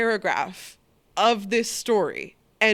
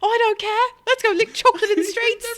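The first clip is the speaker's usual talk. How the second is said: put-on very high, louder childish voice; playful kid; 12 semitones higher, 7 dB louder, faster than usual. Host answering the urchin, high childish chirp.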